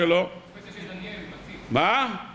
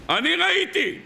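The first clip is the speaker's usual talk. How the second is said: much higher, emphatic claim; soldier insisting; 10 semitones higher than usual. Retold or imitated. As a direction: insist you saw it with your own eyes. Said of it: "ככה מספר החייל".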